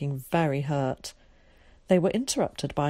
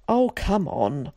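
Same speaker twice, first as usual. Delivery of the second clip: slow, drawn-out cry of protest; exasperated exclamation; cried out; 3 semitones higher, 4 dB louder, slower than usual. A narrator's reading. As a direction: cry it out in disbelief, stretching it. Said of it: "cried Zack".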